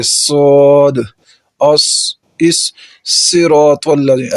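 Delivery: صاد and اس hissed slow; teacher demonstrating whistling letters; whistled sad. Shown as a demonstration. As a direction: stretch the whistle on اس. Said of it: "اس کے اوپر بھی سیٹی کی آواز آئے گی".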